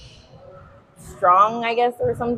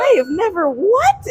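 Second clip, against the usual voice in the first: high, shocked question; stunned that anyone would claim it; indignant disbelief; 12 semitones higher, 4 dB louder than usual. Retold or imitated. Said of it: "And I'm like".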